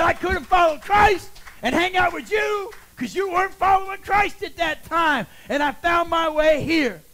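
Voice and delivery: high, loud retold reply, slowing toward the end; emphatic declaration. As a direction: preach the answer at your old friend. Acted forceful answer to friend.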